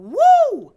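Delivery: loud, high-pitched woo; celebratory whoop. Real, not acted. Celebrating a game show win, loud whoop. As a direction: let out a high whoop of victory.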